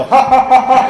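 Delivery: high, punched-out mock laugh; string of separate put-on ha's; imitated laugh. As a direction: act out two buddies cackling on cue. Jack and John laugh together.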